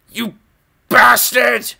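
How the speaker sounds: high, loud mock insult at the viewer; mock outraged yell.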